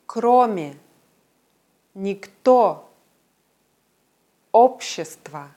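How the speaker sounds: each word alone, its stressed O clear and long, from "Новый" to "общество". Shown as a demonstration. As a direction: draw out the stressed O in "работа", "никто" and the rest, pausing between words. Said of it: "Ein betontes O ist deutlich und lang".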